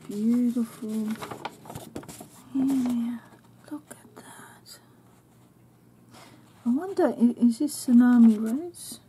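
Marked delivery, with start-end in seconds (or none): 3.7-4.8 awed whisper. Real, not acted. Awed unboxing of gifts.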